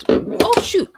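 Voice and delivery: sudden high, loud oh shoot then a sharp gasp; real fright as something nearly falls; startled cry. Real, not acted. Streamer nearly dropping something off her desk, startled cry.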